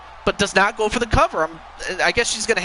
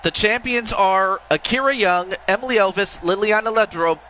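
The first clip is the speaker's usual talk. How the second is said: slow, formal ring announcer; wrestling commentator; 5 dB quieter than usual. Acted. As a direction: draw out the big announcement. Reads out the champions.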